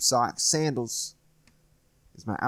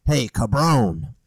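slow, louder mock Puerto Rican accent; drawn-out Spanish-accented greeting like Al Pacino; bad Al Pacino Puerto Rican accent; 6 dB louder, much slower than usual. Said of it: "Al Pacino and Carlito's way".

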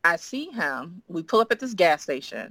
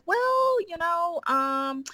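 awkward hesitation; 12 semitones higher, much slower than usual. Retold or imitated. Awkward start of confessing to professor.

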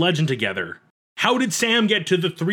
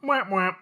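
talking between kisses; 4 semitones higher, 4 dB quieter than usual. Real, not acted. Sign-off with blown kisses.

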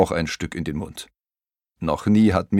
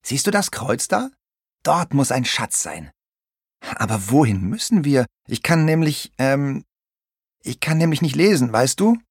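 high put-on pirate voice, eager then halting; small captain awkwardly confessing; little pirate captain, sheepish; 7 semitones higher than usual. A narrator's reading. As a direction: turn from excited to embarrassed, staying high. Little pirate explains map, admits secret.

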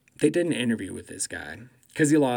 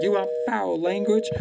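retold retort; 8 semitones higher than usual. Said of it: "And she was like".

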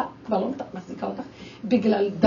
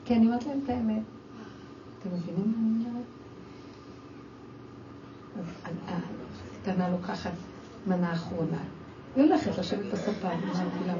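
ordinary talk

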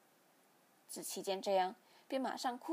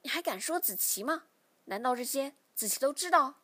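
rising, louder angry challenge; glaring reproach; 3 semitones higher, 6 dB louder than usual. A narrator's reading. Father glares at his son and angrily demands answers. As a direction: throw it at him with a glare. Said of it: "父亲瞪着我说".

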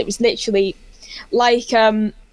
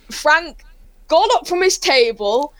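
normal talking voice

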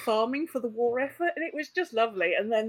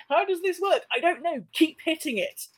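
higher, hurried mock confusion; bewildered players; 7 semitones higher than usual. Players trying to kill things in Silithus, mock flustered. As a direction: quote flustered players in a raised pitch.

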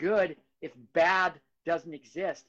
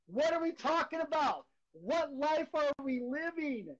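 amazed disbelief; 10 semitones higher, slower than usual. Real, not acted. Marvelling at his own life.

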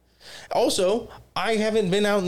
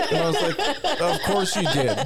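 laughing; 3 semitones higher than usual. Retold or imitated. Repeats reply to mom.

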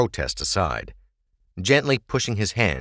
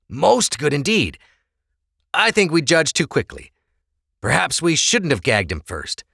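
lightly voiced greedy officer; scheming officer; 8 semitones higher than usual. Officer to comrades, lightly greedy scheming. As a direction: savour the opportunity.

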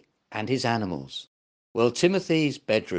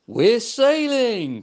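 high, slow, loud whoop; excited cry; 9 semitones higher, 6 dB louder, slower than usual. Acted. Monkey thrilled the raft is moving, loud and high. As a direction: call out the thrill of sailing. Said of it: "cried Woeful".

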